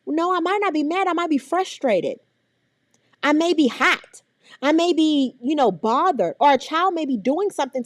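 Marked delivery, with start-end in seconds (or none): none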